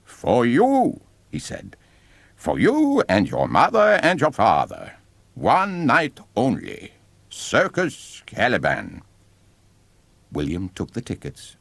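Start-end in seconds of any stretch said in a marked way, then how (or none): 0.0-9.0 higher, slow, showman's ringmaster voice; a circus barker savouring each word; sly ringmaster. A narrator's reading. Ringmaster offering the family tickets, slow and showy.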